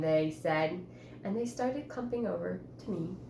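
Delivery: ordinary talk, nothing marked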